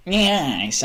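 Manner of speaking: "Nice" pitched high, stretched with a y-glide, like "nyaice"; "Nice" as a high, drawn-out "nyaice", falling at the end; quirky catchphrase. Real, not acted. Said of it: "I don't know why I say that".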